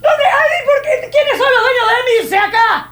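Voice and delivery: laughing shout. Retold or imitated. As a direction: yell for the owners, half laughing. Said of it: "ahí sí grite". (grito entre risas)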